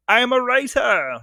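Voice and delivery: high, drawn-out silly voice; put-on proclamation. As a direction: announce it in a stretched, high-pitched put-on voice. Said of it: "a safe bet is always to say".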